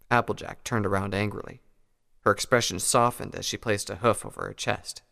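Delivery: ordinary delivery, nothing marked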